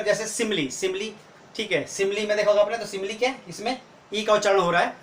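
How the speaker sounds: normal talking voice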